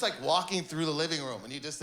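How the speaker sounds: normal talking voice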